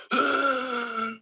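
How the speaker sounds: sharp, labored gasp for air; imitated struggling inhale. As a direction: gasp like a dying man fighting for breath. Describing dad's labored breathing.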